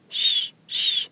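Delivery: soft whispered sh-, sh- like sand underfoot; quiet voiceless shushing of sandy grit; sibilant sh sounds. Shown as a demonstration. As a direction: hiss the sh- gently, as grit under a shoe. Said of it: "Its sibilant sh".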